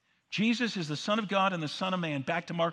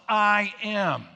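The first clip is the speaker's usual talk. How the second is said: bold declaration; 4 semitones higher, 8 dB louder than usual. Acted. Jesus's bold answer voiced.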